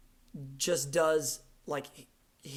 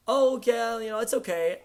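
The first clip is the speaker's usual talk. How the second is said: high, loud mock "oh yeah"; mock casual approval; 7 semitones higher, 7 dB louder than usual. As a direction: put on an offhand reaction, pitched up. Imitates a lukewarm first reaction.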